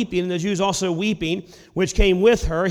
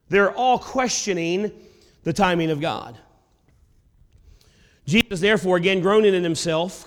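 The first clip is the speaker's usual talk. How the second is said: normal talking voice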